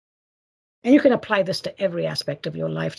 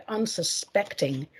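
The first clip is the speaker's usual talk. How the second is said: usual speaking voice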